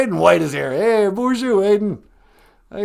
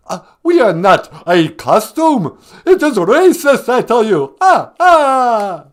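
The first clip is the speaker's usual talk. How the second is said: the Count getting worked up; 3 semitones higher, 6 dB louder than usual. Acted. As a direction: Count voice, louder and laughing ah ah. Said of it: "You don't sound that mad".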